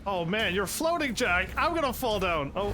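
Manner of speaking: panicked cry as Jack topples; losing balance. Real, not acted. [name] floats off the ledge.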